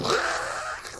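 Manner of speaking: imitated gushing stream hiss; spluttered rushing-water sound; water noise. Man mimicking his strong stream at the urinal, spluttering water noise. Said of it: "it was making the water noise".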